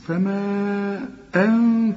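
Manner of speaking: فما أن with the a stretched very long; long madd. Shown as a demonstration. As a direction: hold the vowel in فما four counts. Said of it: "مد أربع حركات". (مد أربع حركات في فما)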